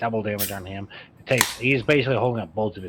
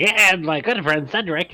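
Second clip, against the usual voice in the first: overly friendly; 8 semitones higher, 6 dB louder than usual. Retold or imitated. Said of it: "remember when I was like".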